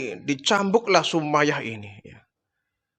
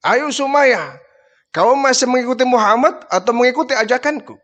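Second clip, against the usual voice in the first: higher, pushy demand; 6 semitones higher than usual. Acted. Abu Jahal presses Sumayyah to choose, higher and pushy. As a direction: press her for an answer.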